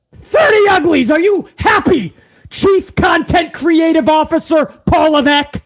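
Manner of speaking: mocking shout. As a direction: bellow it at the boss like a challenge. Ranting shout at the boss.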